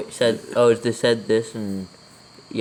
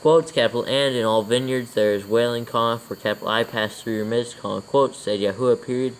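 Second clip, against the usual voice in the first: dictating. Real, not acted. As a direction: read it out word by word for copying. Dictates scripture with punctuation.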